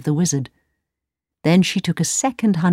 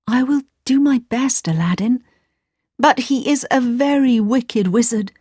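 higher princess voice; lightly voiced princess; 6 semitones higher than usual. A narrator's reading.

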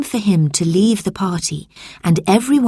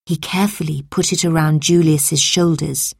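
ordinary delivery, nothing marked

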